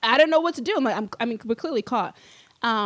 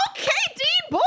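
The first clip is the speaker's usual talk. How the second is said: delighted mock thanks. Dean just fixed everything.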